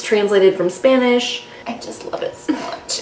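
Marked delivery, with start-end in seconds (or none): 1.7-3.0 whispering. Real, not acted. Gushing over a beloved book.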